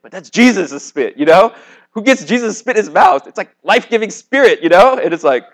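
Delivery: high, loud, giddy enthusiasm; excited gushing. Real, not acted. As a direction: marvel at it with rising excitement. Gushing over Jesus' spit.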